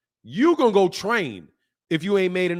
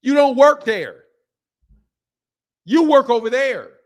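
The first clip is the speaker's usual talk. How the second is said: louder, higher, calling him out; exasperated shout; 5 semitones higher, 8 dB louder than usual. Real, not acted. To the boxer complaining about his network, a loud exasperated shout. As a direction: raise it, he's not listening. Ranting at boxer over ESPN.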